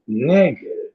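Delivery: high, slow, drawn-out disbelief; mock-shocked call-out, slowed right down; disbelieving challenge. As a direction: stretch it out like you can't believe what you're seeing. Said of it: "I'm like".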